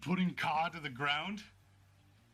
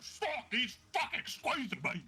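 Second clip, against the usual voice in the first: pitched-up cry of strain; strained wrestler; 8 semitones higher than usual. Acted. Kodlak caught in the hold, strained and higher. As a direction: squeeze the words out under pressure. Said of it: "Kodlak's like".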